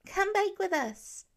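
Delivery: put-on high, slow child's voice pleading; a small boy coaxing his brother to join in; eager little boy. A narrator's reading. Little Nate begging Pete to come bake, high and eager. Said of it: "Nate tugged on Pete's hand".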